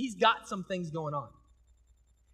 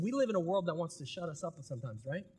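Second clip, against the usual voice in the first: ordinary delivery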